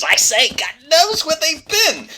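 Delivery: high, loud objection; indignant protest. Acted. Doctor being grabbed by officers, indignant. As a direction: protest loudly at being handled. Seized man protests in scuffle.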